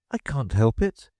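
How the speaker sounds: ordinary delivery, nothing marked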